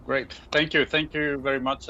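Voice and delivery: ordinary talk, nothing marked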